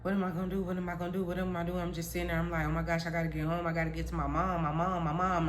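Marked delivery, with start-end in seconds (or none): 0.0-1.8 very soft, low muttering to yourself; hushed, even self-talk; anxious inner thought. Retold or imitated. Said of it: "I'm just thinking that". 2.7-5.5 soft, low, rapid self-talk; quiet worried looping thought; anxious inner thought. Retold or imitated. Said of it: "I'm just thinking that".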